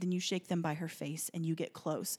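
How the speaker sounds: soft, low instruction to a panicking mother; quiet comfort; gentle reassurance. Retold or imitated. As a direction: imitate a calm, steady helper. Woman calms panicking mother.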